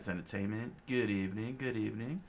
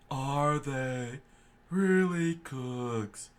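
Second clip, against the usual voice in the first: higher, slow, incredulous officer voice; doubtful officer; 5 semitones higher, 4 dB louder, much slower than usual. Acted. Lieutenant wondering about the cooks, slow and doubtful. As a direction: drag out the disbelief.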